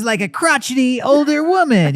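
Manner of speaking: put-on high, creaky old lady voice; a hint of a cranky grandma; crotchety old woman. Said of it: "One of them was".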